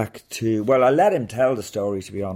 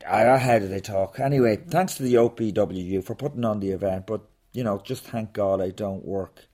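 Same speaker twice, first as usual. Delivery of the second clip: ordinary talk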